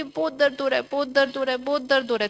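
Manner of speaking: whiny, exaggerated moaning about pain; mock complaining.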